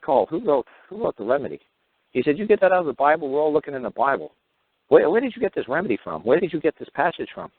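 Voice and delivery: lightly voiced judge, pressing him; a hint of an incredulous judge; quoting the judge. Judge questioning him, quoted.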